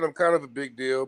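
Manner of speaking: normal talking voice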